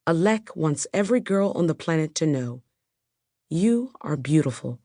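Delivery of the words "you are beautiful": usual speaking voice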